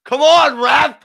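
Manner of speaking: loud, high yell at the ref. Real, not acted. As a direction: shout it at the referee. Yelling at referee.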